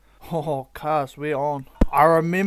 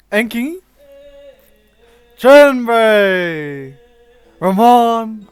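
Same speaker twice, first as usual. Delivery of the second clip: calling out; 6 semitones higher, 9 dB louder, much slower than usual. Real, not acted. Calling out names in the video.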